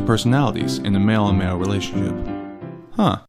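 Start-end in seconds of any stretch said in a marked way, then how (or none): none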